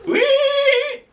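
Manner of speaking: high squealing wee; pig squeal. Shown as a demonstration. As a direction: squeal like a piglet. Pig sound for toddler.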